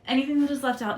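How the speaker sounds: pushing through breathlessness; out of breath. Real, not acted. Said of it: "sorry, I'm out of breath, y'all".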